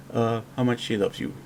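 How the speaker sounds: usual speaking voice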